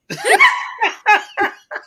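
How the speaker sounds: squealing laugh; high burst of laughter. Real, not acted. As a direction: crack up high.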